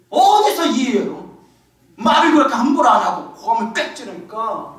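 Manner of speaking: ordinary talk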